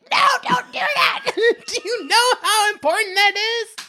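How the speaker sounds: high laughing cry of protest; mock shout. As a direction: yell at them not to toss it. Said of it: "I'm like".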